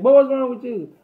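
high, loud mother's scold; scolding mama. Retold or imitated. Mama bursts in on her son, scolding high and loud. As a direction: snap at the boy like an alarmed mom.

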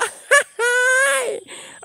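long, high-pitched loud cackle; high shrieking laugh. Real, not acted. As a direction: let out a piercing laugh. Outburst during ghost hunt. (tawa melengking panjang)

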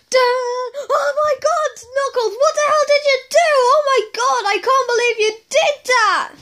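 high-pitched, appalled outburst; outraged scolding. Acted. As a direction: freak out at the culprit in a high voice. Acted discovery of eaten sweets.

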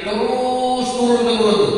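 usual speaking voice

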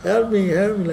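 put-on high child's cry for help; begging child. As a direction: plead like a small boy. Son begging beside mother.

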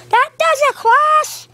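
high, fed-up battle cry; play-fight outburst. Pretend. Toy hero who has had enough, high battle cry.

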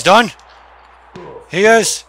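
loud, high cheer; excited shout. Real, not acted. Gamer cheering his wrestler's move, excited shout. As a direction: crow over the downed wrestler. Celebrating a win in the game.

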